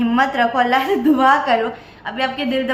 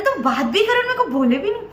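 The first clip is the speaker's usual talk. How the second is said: retold scolding, higher and upset; imitating angry relative; 8 semitones higher than usual. Relative scolding niece for hiding the relationship, mock upset and higher. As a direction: mimic a hurt, angry relative, pitched up. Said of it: "उनको गुस्सा आया".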